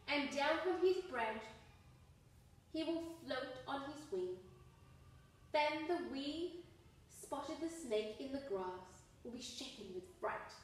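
ordinary delivery

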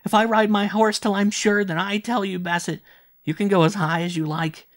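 put-on high, loud delirious boy; shrill, overexcited child's outburst; feverish excitement. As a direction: cry it out in frantic triumph. Fevered boy talks excitedly to Bassett.

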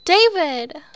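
loud high call across the room. Acted. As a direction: shout his name to find him. Calling out to David.

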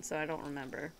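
ordinary talk, nothing marked

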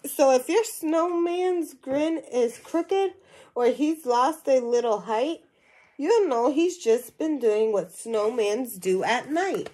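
lively storybook reading to a class; animated read-aloud voice for young listeners; reading to children. Acted. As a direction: read it out like a picture book for kids. Teacher reads picture book aloud.

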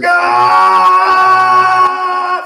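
very high, loud power-up scream; loud powering-up yell; straining scream. Pretend. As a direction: scream like going Super Saiyan. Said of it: "you would just hear".

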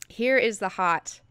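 ordinary delivery

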